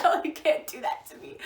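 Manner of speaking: a mild mock protest, half laughing; mock outrage. Scolding Sarah for the wrong line, mock outraged. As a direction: playfully scold her friend. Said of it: "I was like".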